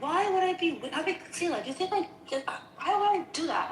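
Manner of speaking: high-pitched, incredulous retort; indignant protest. Retold or imitated. Retelling her own defence against an accusation, indignant.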